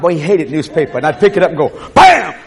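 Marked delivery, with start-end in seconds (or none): 1.9-2.3 sudden loud high bam. Retold or imitated. Startling the sleeping dog with a newspaper, loud bam.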